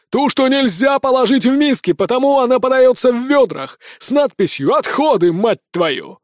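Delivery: high, irate rant dropping at the end; exasperated rage. Acted. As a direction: berate the assistant, then curse. Colonel rages about food for squad. (раздражённая гневная тирада)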